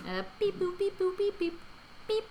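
high chirpy robot beeps; playful gadget boot-up beeps; mock beeping. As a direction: make the gadget beep. Gadget boots up, beeping sounds.

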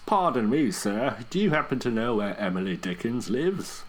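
hammed-up British accent; posh British accent. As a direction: ask like a proper Victorian gent, British accent. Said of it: "put on accents".

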